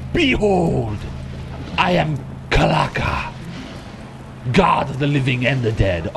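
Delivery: hammed-up self-proclaimed god; grand villain. Boss Kalaka declaring himself a god, grand villain.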